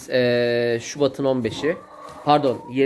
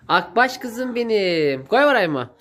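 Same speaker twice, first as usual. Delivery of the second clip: talking to a pet; 8 semitones higher, slower than usual. Real, not acted. Coos at the calf. (hayvana sevgiyle seslenen yüksek ses)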